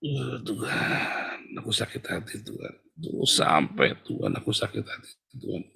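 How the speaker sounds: very soft, half-whispered lament; hurt prayer. Acted. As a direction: groan quietly to God, as if wounded. Acting out a hurt believer praying. (meratap lirih dalam doa)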